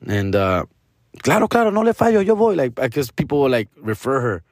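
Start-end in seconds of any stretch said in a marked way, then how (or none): 1.2-2.5 high, eager put-on voice of his mom; mom's voice. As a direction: play mom cheerfully accepting the job. Mom voiced agreeing to a job.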